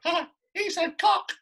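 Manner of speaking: put-on high, slow childish gasp of delight; child's gleeful voice. Schoolkid in the audience telling on the actor, high childish glee. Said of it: "this kid in the audience went".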